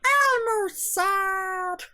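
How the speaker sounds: very high, very slow sad puppet voice; sad Elmo. Acted. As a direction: drag it out like a sulking puppet. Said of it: "What about when Elmo's sad?".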